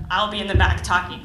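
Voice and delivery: ordinary talk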